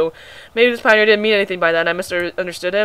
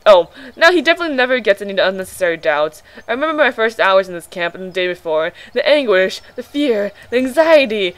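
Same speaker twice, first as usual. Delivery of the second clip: usual speaking voice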